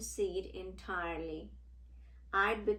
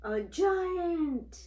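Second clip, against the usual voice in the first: higher, louder awed reveal; dramatic gasp; 9 semitones higher, 6 dB louder than usual. Acted. Seed reveals the huge giant with awe.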